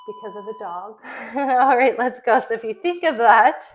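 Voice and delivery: loud, pumped-up trainer cues; workout coach calling. Acted. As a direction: call the reps over the music. Calls out the workout over music.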